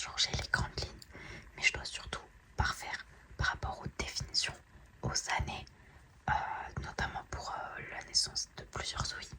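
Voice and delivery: whispering so the family sleeps; hushed late-night whisper. Real, not acted. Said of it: "Je peux pas parler plus fort que ça".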